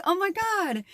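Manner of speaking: high, gushy retold reaction; touched gushing. Partner hearing he'd love to snuggle, high touched gushing. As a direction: let it rise into a melting squeal. Retold delight at his tender answer.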